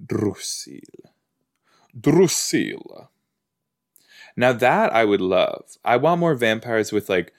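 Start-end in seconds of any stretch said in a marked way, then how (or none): 0.0-0.8 soft, mock European accent on the name. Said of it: "like, European accents". 2.0-3.1 higher, drawn-out mock European accent. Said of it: "like, European accents".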